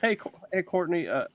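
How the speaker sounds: high put-on Southern drawl, slow; a hammed-up Southern plea about money; southern accent. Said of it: "I gotta make it southern".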